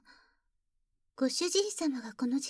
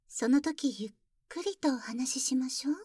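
whispering; 3 semitones lower than usual. Acted.

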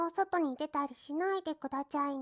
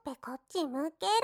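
normal talking voice

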